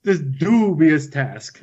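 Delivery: drawn-out, mimicked "dubious task"; slowly overstress the syllables of "dubious task"; "dubious task" stretched out with mock-odd emphasis; imitated odd emphasis. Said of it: "put the emphasis on the wrong syllables".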